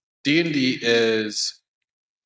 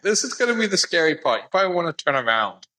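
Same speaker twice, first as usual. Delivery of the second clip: high, quick retold warning, falling in pitch; cousin's warning; 6 semitones higher, faster than usual. Player recalls cousin warning about the Flood, imitated higher and quicker. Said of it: "my cousin went like".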